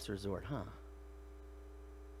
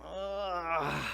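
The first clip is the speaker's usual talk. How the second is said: long, drawn-out sleepy groan; acted groggy groan; waking groan. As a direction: groan like someone just woken.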